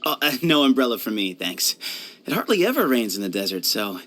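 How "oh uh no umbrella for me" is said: ordinary talk, nothing marked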